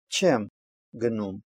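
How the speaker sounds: careful demo with the weight on "Чем"; stress on auxiliary verb. Shown as a demonstration. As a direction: hit "Чем", pause briefly, let "гнум" fall away. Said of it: "ударение ставится на вспомогательный глагол".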